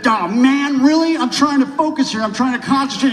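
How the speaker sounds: high, loud acted rant; angry outburst. Actor telling director to get off his set, high angry shouting. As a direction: blow up at the intruder, building to a shout. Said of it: "I got so angry".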